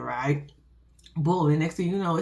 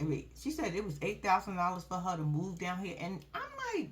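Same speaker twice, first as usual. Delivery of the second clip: ordinary delivery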